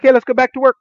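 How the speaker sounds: lightly voiced kids; breezy kids. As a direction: shrug it off cheerfully. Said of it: "they were like".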